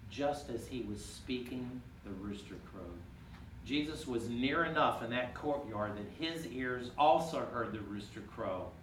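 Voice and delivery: ordinary delivery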